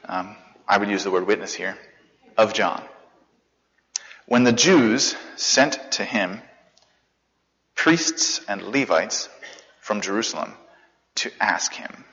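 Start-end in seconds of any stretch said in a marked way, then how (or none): 4.3-12.0 slow, weighty scripture reading; measured Bible reading. Real, not acted. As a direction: read it out slowly, each phrase weighed.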